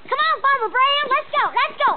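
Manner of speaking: high, urging Southern farm voice; excited put-on Southern accent. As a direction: hurry the farmer along in a Southern accent. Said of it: "doing the Southern accent".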